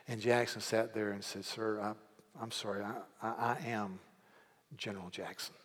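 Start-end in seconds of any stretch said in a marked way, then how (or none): 1.4-5.6 much softer, hesitant, humble reply; a soft, halting confession of who he is; humble apology. Retold or imitated. Jackson humbly answers the farmer.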